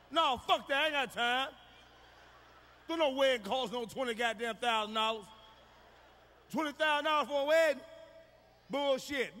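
loud rant. Real, not acted. Comic ranting to the crowd about a twenty thousand dollar wedding, shouted. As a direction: yell it out, outraged at the price.

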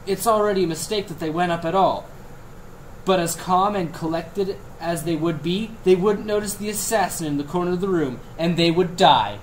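snide TV pundit rant; hammy editorial punchline read; mock news commentary.

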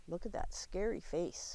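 hushed aside; whisper. Real, not acted. The teacher points out the scary owl to the children, whispering. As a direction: lean in and whisper it.

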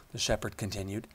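ordinary delivery, nothing marked

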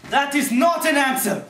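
loud, high outburst; exasperated yell at full volume; angry shout. Acted. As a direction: shout it back, fed up with the reply. Acted quarrel, rejects the answer.

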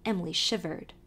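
ordinary delivery, nothing marked

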